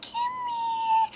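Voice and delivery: put-on high sing-song baby talk; coaxing a kitten. Coaxing a shy kitten through the fence, high cooing baby talk. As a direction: coo it high to lure the kitten closer. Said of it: "We were like".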